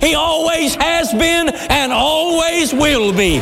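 high ringing shout at the peak; preaching shout. Real, not acted. Preacher shouting the climax to the congregation, high and full. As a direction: cry it out over the room.

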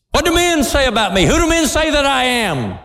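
high, quick questioning voice; voicing Jesus. Preacher voicing Jesus questioning his disciples, high and quick. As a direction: ask it high and fast as Jesus.

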